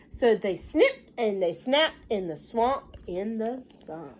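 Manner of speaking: put-on sing-song read-aloud for a child; lilting picture book reading; story narration. A narrator's reading. Mother reading a counting book to her child, sing-song.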